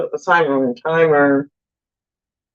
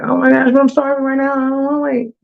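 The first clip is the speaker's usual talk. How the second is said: higher, pleading whine of a hungry wife; mock impatient complaint; 9 semitones higher than usual. Wife to husband who wants to grill longer, mock impatient. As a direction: act starving and unwilling to wait, pitched up. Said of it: "And I'm like".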